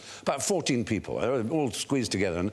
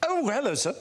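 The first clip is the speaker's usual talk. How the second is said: sudden politeness; 13 semitones higher than usual. Retold or imitated. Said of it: "another part just overtook it and went".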